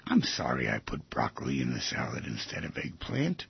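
low, very quiet apology; hushed, low-voiced sorry; soft apology. Acting out a calm apology.

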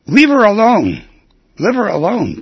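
high, loud little-dog yell of ownership; yappy Chihuahua shout. Acted. Chihuahua shouting at the rival dogs, loud and possessive. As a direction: bark it out like a tiny dog guarding his girl. Tiny Chihuahua claims the female.

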